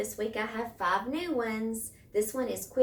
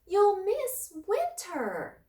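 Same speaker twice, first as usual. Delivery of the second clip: much higher, louder squeaky mouse voice; little mouse crying out; 11 semitones higher, 6 dB louder, slower than usual. A narrator's reading. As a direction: cry out like a tiny worried mouse. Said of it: "Mouse cried".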